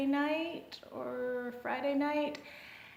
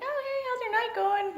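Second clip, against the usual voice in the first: put-on girl's voice. Girl voiced by narrator.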